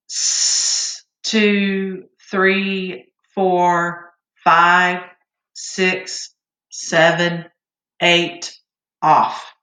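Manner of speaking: steady counted beats, one per second; rhythmic count-off. Shown as a demonstration. Choir teacher counting breathing beats for students, steady rhythmic count. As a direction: keep the beat for the class like a choir teacher. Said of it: "I'm gonna give you a count off".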